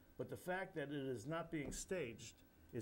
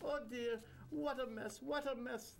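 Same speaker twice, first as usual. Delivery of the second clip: high, bubbly Indian guru clucking; tut-tut with childlike glee; bubbly amused Indian accent; 9 semitones higher than usual. Acted. Guru amused by the narrator's mess, Indian accent. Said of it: "in a bubbly, amused manner".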